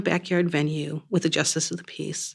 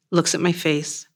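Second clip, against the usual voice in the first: plain reading voice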